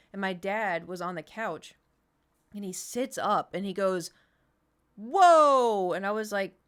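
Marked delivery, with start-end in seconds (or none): 5.0-5.9 loud, high, drawn-out "whoa" of awe; amazed shout. Retold or imitated. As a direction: act out dad sitting up astonished. Dad's amazed reaction, acted out.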